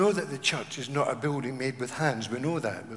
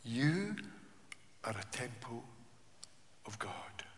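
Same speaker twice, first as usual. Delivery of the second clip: dropping to a near whisper; hushed, reverent scripture quote; 4 semitones lower, 11 dB quieter than usual. Acted. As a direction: let the verse sink to a whisper. Quoting scripture softly.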